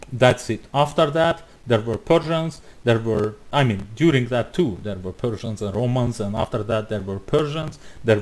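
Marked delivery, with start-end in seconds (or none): none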